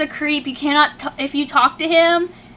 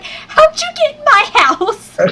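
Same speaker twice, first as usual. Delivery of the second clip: much higher, slow, confused squeak; mock confused squeak; 14 semitones higher, 5 dB louder, slower than usual. Retelling how she reacted to the creep, squeaking high. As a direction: play up the baffled squeal. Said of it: "I'm sitting there like".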